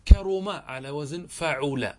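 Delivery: slowly, leaning on the middle u vowel; damma on middle letter. Shown as a demonstration. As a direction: draw out the u in the middle of "كرم" and "فعول". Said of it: "لاحظ صارت العين مضمومة". (إبراز ضمة العين ببطء)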